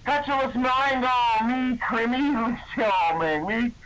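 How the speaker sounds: mock incantation scream. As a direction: drag out the fake spell like a cheesy wizard. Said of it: "Then he screamed".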